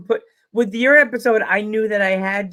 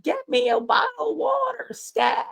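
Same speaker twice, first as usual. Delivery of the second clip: much higher, breathless frantic demand; bald guy panicking. Retold or imitated. Host imitating an Instagram guy freaking out, high frantic demand. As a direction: squeak it out like a panicked man. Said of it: "like that bald guy".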